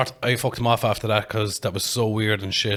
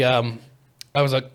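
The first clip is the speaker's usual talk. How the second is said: ordinary delivery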